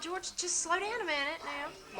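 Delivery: drawn-out, hushed plea to slow down; slow and soft. Retold or imitated. Wife telling George to slow down, slowly and softly. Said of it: "I have to tell him".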